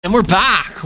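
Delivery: loud, high cheerful comeback; excited return. Real, not acted. Returning to stream after break.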